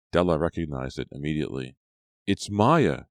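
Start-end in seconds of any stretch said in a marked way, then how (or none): 2.3-3.0 cried out. A narrator's reading. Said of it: "she cried".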